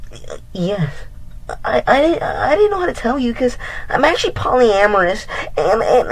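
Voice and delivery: put-on high, timid stuttering girl voice; squeaky guilty admission; meek stammering ex. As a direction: mimic her nervous stammering confession. Ex confesses to side pieces.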